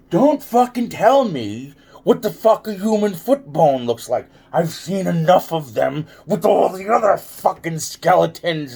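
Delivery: high, loud angry rant; retold angry outburst. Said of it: "I'm like".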